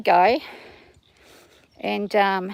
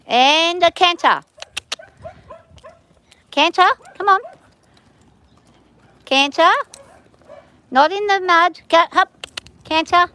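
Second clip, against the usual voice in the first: higher, louder drawn-out calls; called command; 7 semitones higher, 4 dB louder than usual. Real, not acted. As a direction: call the canter across the arena. Calling commands to her horse.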